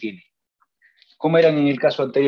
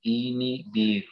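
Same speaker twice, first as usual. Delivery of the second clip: inhibir dragged out slowly, softer; dictado lento; 6 dB quieter, much slower than usual. Shown as a demonstration. Dicta la palabra para silabearla. (inhibir, dictado pausado)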